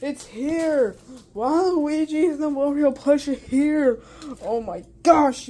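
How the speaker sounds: real excited outburst, starting high and loud. Real, not acted. Unboxer telling viewers the plush are here, so excited. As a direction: burst out high, then keep the thrill going. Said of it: "I am so excited right now".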